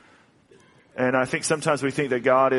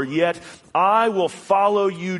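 earnest declaration; 6 semitones higher, 4 dB louder, slower than usual. Acted. Declaring resolve in psalm.

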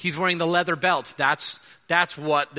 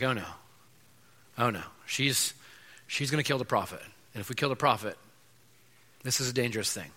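worried king; 6 semitones lower, 7 dB quieter, faster than usual. Retold or imitated. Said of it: "Because he's like".

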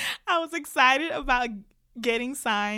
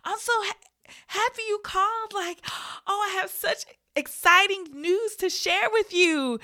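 bubbly, much higher, gasping with joy; excited reply; 9 semitones higher than usual. Retold or imitated. Imitating her own excited reply to the caller. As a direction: gush like you can't wait to share the news. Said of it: "And I'm like".

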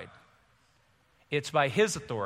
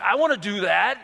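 put-on eager little boy; higher, louder, like an excited five-year-old; Peter; 4 semitones higher, 6 dB louder than usual. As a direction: pipe up with childlike eagerness. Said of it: "He's like a five-year-old boy".

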